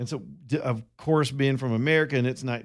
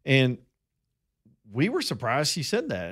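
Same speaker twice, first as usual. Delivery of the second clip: ordinary talk, nothing marked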